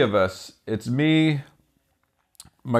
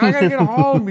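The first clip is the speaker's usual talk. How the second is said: mock cabbie, much higher, refusing; cab driver refusing; 12 semitones higher, 5 dB louder than usual. Cab driver refusing the drunk passengers, mock high voice. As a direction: play the stubborn driver, pitched way up. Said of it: "yelling and fighting".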